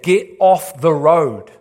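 slow, loud urgent warning yell; a parent's mock yell of alarm from the house; parent screaming. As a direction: shout the child off the road, drawn out. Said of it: "the parent is screaming".

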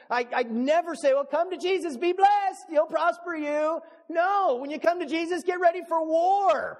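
thundering sermon climax; full-force preaching. Acted. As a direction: preach it hard to the crowd.

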